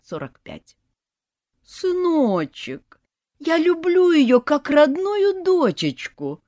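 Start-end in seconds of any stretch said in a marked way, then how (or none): 1.6-6.4 high, slow syrupy mother coo; doting mother cooing. A narrator's reading. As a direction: coo sweetly at her son. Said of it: "проворковала мать".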